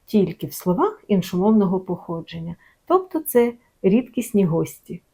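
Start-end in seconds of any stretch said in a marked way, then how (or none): none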